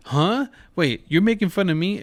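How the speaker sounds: high-pitched, incredulous double take; mock surprise. Dad realizing his son is roasting him, mock surprise. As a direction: act taken aback, pitch jumping up. Acting out his startled reaction.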